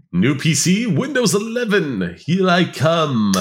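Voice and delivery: high, drawn-out sing-song cheer; mock-triumphant announcement. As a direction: announce it like a big arrival, stretched and high. Mock cheer about unwanted upgrade.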